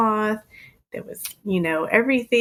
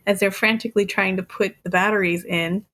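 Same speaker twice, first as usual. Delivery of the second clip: normal talking voice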